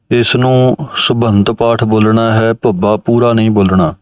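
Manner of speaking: ordinary delivery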